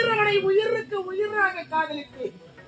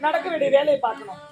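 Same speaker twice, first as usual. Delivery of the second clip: soft consoling; 4 semitones lower, 4 dB quieter, faster than usual. Acted. Woman consoles a crying stranger.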